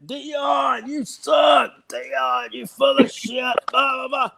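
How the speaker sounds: high-pitched mock heckling; imitated trash talk. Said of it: "they were talking shit to him".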